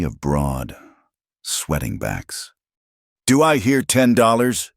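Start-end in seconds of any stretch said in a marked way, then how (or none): 3.2-4.7 higher, louder auctioneer call; auctioneer yell. A narrator's reading. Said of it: "Doyle yelled, trying to sound like a real auctioneer".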